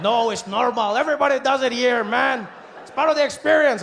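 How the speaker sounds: put-on Mexican accent. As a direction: vendor insisting it's normal, Mexican accent. Vendor insists, voiced.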